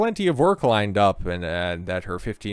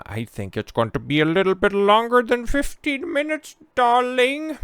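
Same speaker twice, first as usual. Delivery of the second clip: much higher, put-on affected accent; mocking Dylan's accent; 13 semitones higher than usual. Host quoting Dylan's reply to the troll, mocking put-on accent. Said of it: "The fuck is with that accent?".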